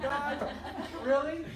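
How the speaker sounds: breaking into laughter mid-word; laughing at God's timing, caught off guard; laughing disbelief. Real, not acted. Preacher retelling the answered prayer, laughing. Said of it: "I just start busting out laughing".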